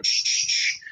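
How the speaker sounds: soft unvoiced knocking imitation; straw knock sound. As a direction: whisper a dry tapping noise. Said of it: "it's just like".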